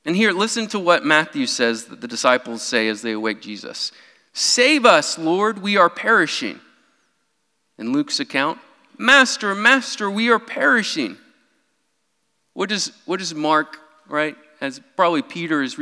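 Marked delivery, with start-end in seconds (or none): 4.3-6.6 higher, urgent cry of the disciples; panicked plea. Retold or imitated. 9.0-11.2 higher, urgent cry of the disciples; panicked plea. Retold or imitated.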